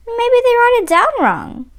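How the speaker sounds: hopeful, pitched well up; grasping at a last hope; hopeful plea. Acted. Boy at the shoe store pleads hopefully, voice high. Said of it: "I say".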